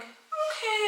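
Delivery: very high, drawn-out uneasy okay; hammed-up high-pitched unease; awkward mock okay. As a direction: squeak out a wary okay, retold. Retold awkward reply to stranger.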